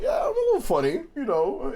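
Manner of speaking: very high, mock-bashful boy voice; bashful young self. His fifteen-year-old self answering a compliment, high mock-bashful. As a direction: pitch way up, hammed-up coy acceptance.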